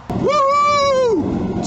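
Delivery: loud, very high whoop of joy; whooping cheer. Real, not acted. Driver celebrating two thousand miles, a loud high whoop. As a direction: let out a victory whoop.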